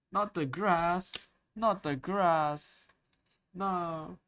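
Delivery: high, drawn-out wail of protest; mock horror. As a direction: plead in hammed-up dread, stretching each word. Mock horror at touching grass.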